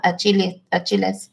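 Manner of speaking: Achilles said in a Mexican accent, ch as in church, ending in a sharp s. Shown as a demonstration. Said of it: "I'm saying it with my Mexican accent".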